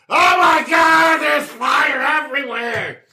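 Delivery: high, loud, slow panicked shout; Sally shrieking in panic; panicked scream. Acted. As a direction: scream from inside the burning house.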